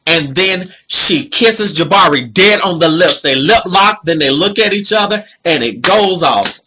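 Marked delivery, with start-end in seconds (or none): none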